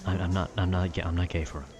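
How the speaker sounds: ordinary talk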